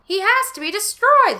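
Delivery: high, loud shout. Acted. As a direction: shout it at his lookalike.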